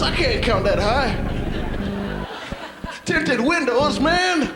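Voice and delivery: high-pitched, comic mayor voice; squeaky caricature of a befuddled official; small-town mayor counting cars. Acted. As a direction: call to passing cars in a high voice. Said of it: "He'd be like".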